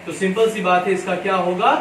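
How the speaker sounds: ordinary talk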